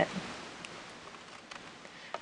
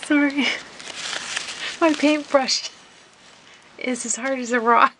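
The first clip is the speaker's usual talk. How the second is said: higher voice squeezed by real laughter; helpless laughing; 7 semitones higher than usual. Real, not acted. Crafter reports a ruined paintbrush, helpless laughing. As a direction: push the words out through giggles.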